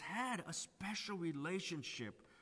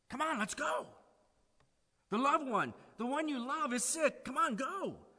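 eager, louder, higher hurry-up; urgent hurry; 8 semitones higher, 6 dB louder than usual. Pretend. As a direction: push them out the door now. Imagined urgent reaction.

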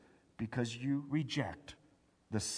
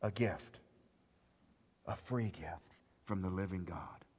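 ordinary delivery

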